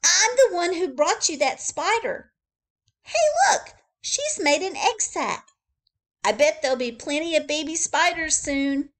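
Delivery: put-on high, loud excited child; excited boy. Billy showing the zookeeper the egg sac, high and loud. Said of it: "he said to the zookeeper".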